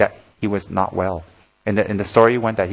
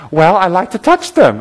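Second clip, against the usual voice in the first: higher, louder, drawn-out character voice; lightly voiced eccentric monk, slowed and pitched up; the monk's answer; 9 semitones higher, 7 dB louder than usual. Monk explaining why he chases women, lightly put-on voice.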